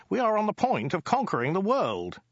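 very high, insistent plea; high-pitched, emphatic urging; urgent. Acted. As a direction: press the point urgently, voice pitched right up. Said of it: "interrupted Ogdai urgently".

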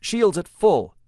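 loud, high battle report; shouting orders over battle. A narrator's reading. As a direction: call it over the roar of engines. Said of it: "he declared".

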